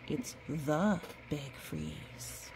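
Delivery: "It's the big freeze" said slowly, every word weighted; slow emphatic phrase. Shown as a demonstration. Explaining the capital letters to a child, slow and weighty. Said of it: "B and the F are big".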